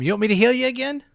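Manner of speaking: high, quick, eager character voice; goblin healer character. Acted. Goblin healer to a wounded companion, high and eager. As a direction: pitch it up and offer keenly. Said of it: "Vinny licks his palm".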